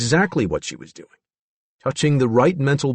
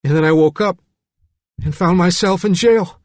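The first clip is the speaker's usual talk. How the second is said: high, loud but less explosive; anxious, raised character voice; frightened Billy; 7 semitones higher, 5 dB louder than usual. A narrator's reading. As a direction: explain it like a bewildered boy. Billy recounts waking.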